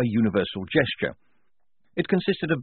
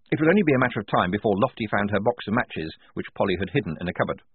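ordinary delivery, nothing marked

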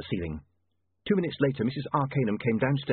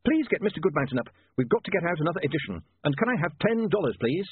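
higher-pitched urgent requests; frantic young man; 7 semitones higher than usual. A narrator's reading. Newspaperman rousing the dwarfs for another edition, frantic. Frantic William voiced in the story.

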